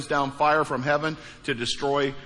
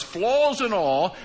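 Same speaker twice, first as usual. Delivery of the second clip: normal talking voice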